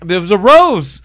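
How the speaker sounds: lightly acted flustered gasp of delight; mock surprise. Acting out the girl's surprised reaction.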